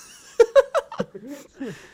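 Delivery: high, loud burst of laughter; laughing. Real, not acted. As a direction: crack up at your own story. Cracks up at own impression.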